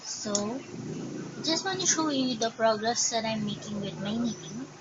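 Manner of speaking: ordinary delivery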